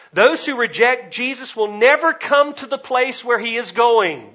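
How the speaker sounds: high, loud warning at full force; forceful preaching. Real, not acted. Preacher warning those who reject Jesus, high and forceful. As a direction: ring it out over the whole room.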